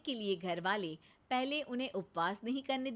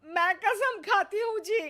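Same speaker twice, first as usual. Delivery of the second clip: high, loud crying vow; wife swearing tearfully to her husband; tearful oath; 11 semitones higher, 8 dB louder than usual. Acted. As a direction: wail the promise through tears.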